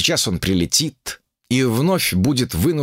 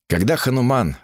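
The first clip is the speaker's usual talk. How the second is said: plain reading voice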